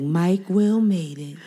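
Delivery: slow, drawn-out words; slow drawn-out mishearing. Retold or imitated. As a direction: stretch each word out slowly. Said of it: "I'd be like".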